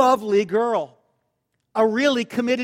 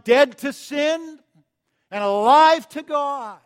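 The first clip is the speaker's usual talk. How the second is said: slow, ringing declaration, higher and louder; baptism pronouncement; 5 semitones higher, 5 dB louder than usual. Retold or imitated. Youth pastor baptizing a convert, solemn proclamation. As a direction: proclaim it like a pastor dunking someone. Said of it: "he would say on the way down".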